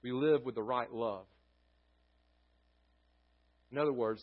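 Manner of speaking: usual speaking voice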